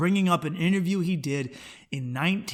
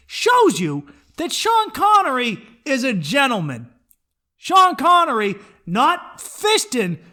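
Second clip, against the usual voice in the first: louder, higher sarcastic praise; loud comic rant; 6 semitones higher, 5 dB louder than usual. Real, not acted. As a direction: hype him up mockingly.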